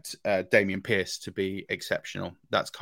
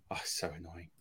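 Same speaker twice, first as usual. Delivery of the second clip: a frustrated aside whispered to himself; annoyed whisper; 3 semitones lower, 15 dB quieter than usual. Real, not acted. Co-host whispers his annoyance at mixing up names. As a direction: breathe it out under your breath, irritated.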